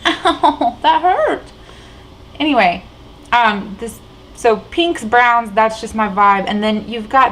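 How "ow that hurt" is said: high, laughing yelp of pain. Real, not acted. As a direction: laugh through the sting of a bumped elbow. Hit elbow on the table.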